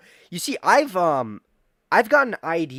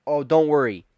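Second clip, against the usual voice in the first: mock reassuring doctor; 9 dB louder than usual. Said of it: "he's gonna be like".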